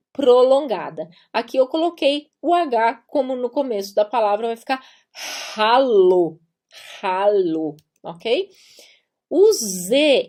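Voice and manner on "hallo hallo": ralo said "hallo" with a breathed h; aspirated H. Shown as a demonstration. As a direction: breathe out into ralo, drawn out and set apart. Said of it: "ele é aspirado". (ralo com H aspirado, "hallo")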